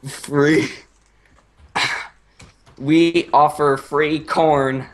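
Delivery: slow, hammed-up offering voice; lightly put-on, drawn out; mock native voice. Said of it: "the Indians say".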